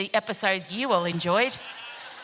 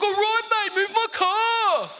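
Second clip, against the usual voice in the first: hectic dad at home; 12 semitones higher than usual. Retold or imitated. Imitating dad demanding the car be moved, hectic. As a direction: stretch it out as an exasperated dad's call. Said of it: "it's like this".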